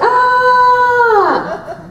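long, high, loud "aah"; mock held scream. Voice actors filling wide-open flaps, long high mock cry. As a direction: hold one high open-mouthed cry. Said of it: "So we're like".

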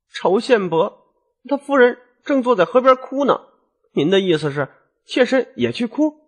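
high-pitched character voice of a wife; puzzled wife. Acted. Wife asks husband if she should cry too, high and puzzled. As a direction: pitch it well up, questioning.

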